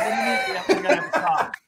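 put-on defensive teen caught off guard; startled protest. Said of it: "And he goes".

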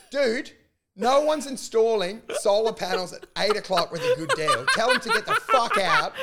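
mock yell. Son urging parents to kick out salesmen, laughing mock yell. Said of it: "And I'm going".

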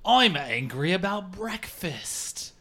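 high, loud mock British accent, slow; a hammed-up British bloke accent; angry British man. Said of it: "a British man who's angry about breakfast".

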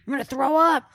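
high, shouted mock workout cry; hammed-up shout over headphones; imitated loud outburst. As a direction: holler it out as the neighbors would hear it. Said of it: "if anyone heard me say this".

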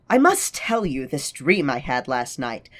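acting out Lala's dream monologue; theatrical reading of a character; dramatic reading. Reads Lala's monologue aloud.

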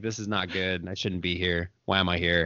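very low, subdued regret; guilty self-talk. Retold or imitated. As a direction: mumble regretfully to yourself, deep and soft. Acted guilty self-talk at drive-thru.

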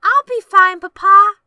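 hammed-up plucky invalid, pitched right up; Tiny Tim. Sick child sending Papa to the mine, high mock-brave voice. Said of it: "You said Tiny Tim".

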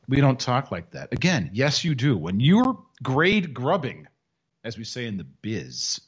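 usual speaking voice

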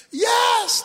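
high, urgent yes; frantic man pleading for rescue; desperate yell. Acted. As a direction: cry out agreement at a high pitch. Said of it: "and he screamed".

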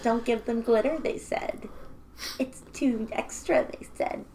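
hushed mocking goddess whisper.

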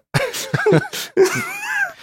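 high squealing burst of laughter; shrieking laugh. Real, not acted. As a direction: let a shrill laugh burst out. Big laugh.

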